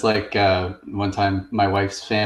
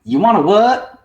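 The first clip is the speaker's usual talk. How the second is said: Southern lady; 9 semitones higher, 5 dB louder than usual. Retold or imitated. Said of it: "the lady just goes".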